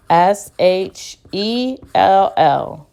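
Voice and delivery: spelled out slowly, letter by letter; slow, careful dictation; slow letter spelling. Shown as a demonstration.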